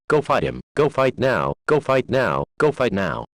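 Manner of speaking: ordinary talk, nothing marked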